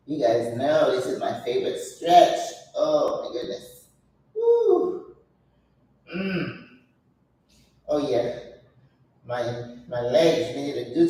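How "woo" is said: a high woo of effort. Real, not acted. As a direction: whoop it out high. Stretch effort.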